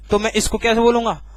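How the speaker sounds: usual speaking voice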